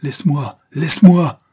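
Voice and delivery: high shout building to a furious peak; foaming-mad outburst; enraged snarl. A narrator's reading. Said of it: "grinçait Diana, l'écume aux lèvres".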